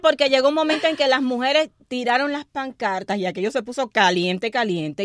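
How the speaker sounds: carried away, almost shouting the story; excited. Real, not acted. Radio host telling co-host the protest got heated, excitedly loud. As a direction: voice jumps up high and loud with excitement.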